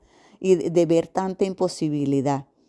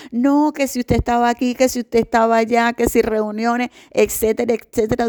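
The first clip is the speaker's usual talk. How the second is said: mocking; 5 semitones higher, 3 dB louder than usual. Mocking the staff's excuses.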